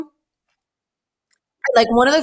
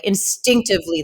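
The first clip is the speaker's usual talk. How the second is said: ordinary delivery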